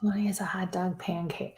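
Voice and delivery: hushed, voiceless reading; whispered read. Real, not acted. Reading the next post to herself, whispered. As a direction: read it under your breath.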